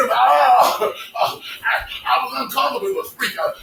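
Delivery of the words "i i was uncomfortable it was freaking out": usual speaking voice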